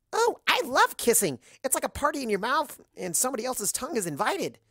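high, bubbly, overexcited party pony; high giddy pony gushing about kissing; Pinky voice. Acted.

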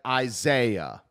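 i-ZAY-ah, slow with the middle syllable leaned on; stress on second syllable. Shown as a demonstration. Offering a word that accents the second beat, i-ZAY-ah stressed. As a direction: stretch the ZAY in Isaiah. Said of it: "that accents the offbeat or the second beat".

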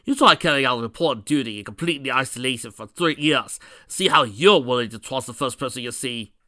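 loud, indignant growled retort; defensive growl. Acted. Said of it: "Knuckles growled".

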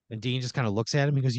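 ordinary delivery

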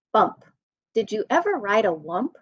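ordinary delivery

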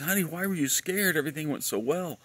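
high-pitched imitation of a reassuring attendant; flight attendant. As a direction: imitate a woman soothing a nervous passenger. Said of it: "one of the, um, attendants".

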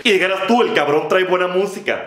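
normal talking voice

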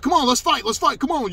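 high, shouted goading; loud, imitated aggressor. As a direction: imitate him daring someone to fight. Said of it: "this is me imitating him".

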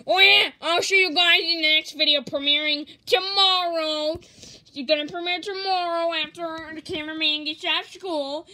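a hint of the goofy villain saying bye; Waluigi voice sign-off. Vlogger signs off as Waluigi, lightly high and loud. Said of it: "Waluigi's just gonna sign off here".

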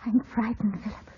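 hushed, scared whisper; frightened whisper. Acted. Young woman whispers her fear to Philip in alarm. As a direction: whisper your fear to him.

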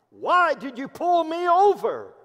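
high, loud indignant demand; angry outburst. Acted. Angry lady snapping at the patrolman who stopped her, indignant. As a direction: act out an affronted driver yelling at an officer. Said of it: "didn't hesitate to express her anger".

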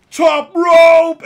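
big yelled top rope call; shouted wrestling call. Pretend. Pretend wrestler yelling at a commenter. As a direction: bellow it like a pro wrestler. Wrestling fantasy of beating trolls.

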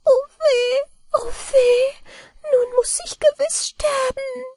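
very high little-man wail, with a cry in it; a tiny man lamenting he must die; high wailing gnome voice; wailing mannikin. A narrator's reading. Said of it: "da klagte das Männlein".

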